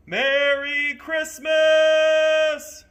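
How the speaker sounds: very loud, high, drawn-out shout; long shout. A narrator's reading. Conductor shouting from the moving train, very loud and long. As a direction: hold the greeting long across the distance. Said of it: "he shouted".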